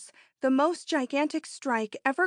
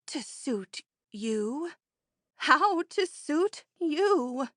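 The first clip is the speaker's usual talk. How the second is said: slow, tearful question, breaking higher; sobbing; 5 semitones higher, much slower than usual. Acted. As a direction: sob it out in disbelief. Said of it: "sobbed the friend".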